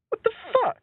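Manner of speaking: high-pitched mock disbelief; incredulous onlooker. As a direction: imitate a baffled passer-by. Mimics baffled onlookers at campus.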